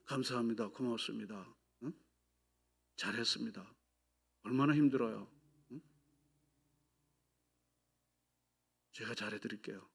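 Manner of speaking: soft, gentle words of gratitude to a spouse; tender thanks. Shown as a demonstration. Preacher demonstrating to the congregation, soft tender thanks. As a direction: say it softly as if to your wife. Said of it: "그럼 표현하십시오".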